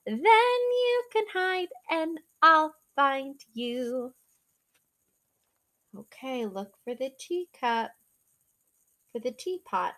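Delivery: ordinary delivery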